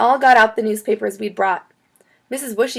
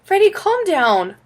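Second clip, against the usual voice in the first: put-on mom calming a child; 10 semitones higher, 5 dB louder, slower than usual. A narrator's reading.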